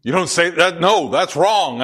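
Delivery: hammed-up rebuke of a commentary; mock scolding. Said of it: "that I go".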